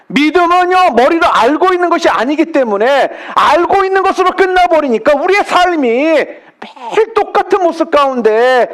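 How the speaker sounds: preacher rising to full force; impassioned preaching. Acted. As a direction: drive the point home to the congregation.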